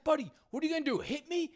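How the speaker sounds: put-on high, sarcastic old-man voice; lightly hammed-up crew boss taunting the driver; mocking old guy. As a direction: needle him in a high mock tone. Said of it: "guy's like".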